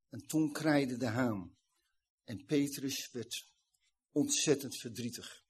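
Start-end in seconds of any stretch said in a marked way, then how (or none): none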